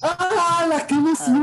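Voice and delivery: squealed high mock excitement. Mock excited reaction to news.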